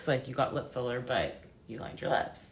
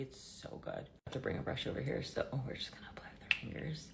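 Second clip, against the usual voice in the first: whisper; 9 dB quieter than usual. Real, not acted. Whispered aside while applying.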